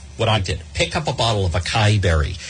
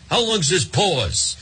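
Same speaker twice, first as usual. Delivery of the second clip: slow, higher mock of a caller asking; mocking exasperated question; 5 semitones higher, slower than usual. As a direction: sneer at the silly question, drawn out and higher. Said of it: "People were sending me emails".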